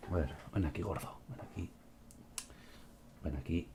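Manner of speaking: soft, hushed calling to a pet; coaxing the cat. Real, not acted. Streamer softly calling his upset cat to come. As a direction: coax the scared cat over gently.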